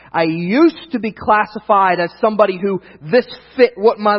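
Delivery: loud, slow, emphatic preacher delivery; preaching. Real, not acted. Preacher applying the verse to his life, emphatic preaching. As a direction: drive each phrase home to the congregation.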